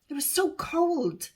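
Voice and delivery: put-on slow, shivery girl's voice; shivering. A narrator's reading. Honesty shivers after washing in icy water. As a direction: say it through chattering cold. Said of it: "she shivers".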